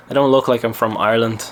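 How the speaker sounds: ordinary talk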